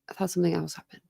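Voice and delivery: soft unvoiced whispered aside. Real, not acted. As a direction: whisper it to yourself. Muttered aside to herself.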